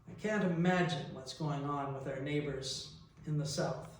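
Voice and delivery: ordinary talk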